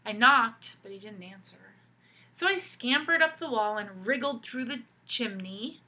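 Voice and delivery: put-on lively read-aloud for kids; storybook reading. A narrator's reading. Teacher reading a picture book to children, animated storytelling. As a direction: tell the story to the class with expression.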